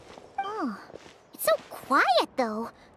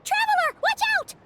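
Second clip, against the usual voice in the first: urgent warning shout; 11 semitones higher than usual. Acted. Warning shout before ambush.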